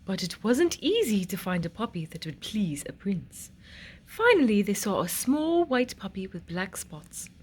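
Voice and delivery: plain reading voice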